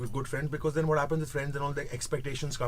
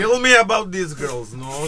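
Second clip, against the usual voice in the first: put-on Russian accent, louder; 6 semitones higher, 9 dB louder than usual. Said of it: "say that in Russian".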